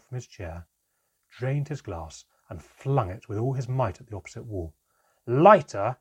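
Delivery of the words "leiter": very loud, higher bellowed name; furious roar. A narrator's reading. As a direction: roar it at her. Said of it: "he roared at my mother".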